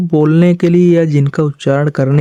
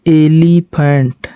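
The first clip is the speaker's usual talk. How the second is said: Elephant drawn out very slowly, syllable by syllable; a teacher spelling out elephant at crawling pace; slow demonstration; much slower than usual. Shown as a demonstration. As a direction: stretch elephant out slowly so pupils hear each sound. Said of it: "elephant, देखिए".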